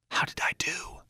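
very soft whispered question. A narrator's reading. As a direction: whisper anxiously to a friend beside you. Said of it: "then whispered to me".

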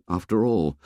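ordinary delivery

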